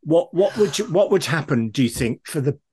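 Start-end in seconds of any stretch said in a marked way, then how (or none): none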